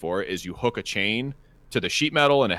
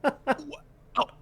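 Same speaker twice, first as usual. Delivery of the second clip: high, squeaky disbelieving what; incredulous what; 4 dB quieter than usual. Retold or imitated. Reacting to the coworker's answer, incredulous squeak. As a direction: let it crack in disbelief.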